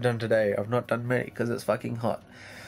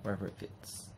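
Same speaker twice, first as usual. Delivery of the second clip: quiet aside; 2 semitones lower, 8 dB quieter than usual. Real, not acted. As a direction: drop to a soft aside. Aside to the editor.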